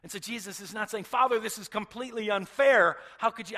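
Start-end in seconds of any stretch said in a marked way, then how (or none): 1.0-3.6 loud, high outburst of accusation; angry protest. Acted. Preacher acting a son accusing his Father, angry shouting. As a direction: hurl it as an outraged protest. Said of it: "angry at his father".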